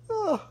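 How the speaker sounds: high, groaning ugh of disgust. Real, not acted. Vlogger reacting to the strip show, grossed-out ugh.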